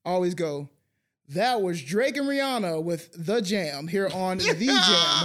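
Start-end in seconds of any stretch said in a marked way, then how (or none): 1.3-5.2 slow, drawn-out DJ back-announce; radio DJ bit. Acted. Joking after songs in the car, radio DJ. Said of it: "how the radios be doing".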